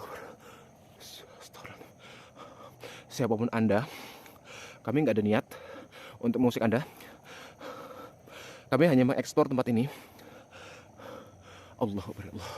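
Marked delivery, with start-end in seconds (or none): 3.1-9.9 louder, speak firmly to the unseen; loud address to spirit. Real, not acted. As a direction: declare it aloud to the spirit.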